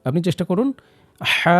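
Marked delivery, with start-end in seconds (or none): 1.2-1.6 হা pushed out as warm breath from the throat; throat ha demo. Shown as a demonstration. Teacher demonstrates হা for repetition. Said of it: "আরেকবার চেষ্টা করে দেখুন".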